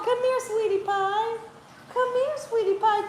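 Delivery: soft, high coaxing to a pet; coaxing puppy call. Real, not acted. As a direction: lure the puppy in sweetly. Calling puppies softly.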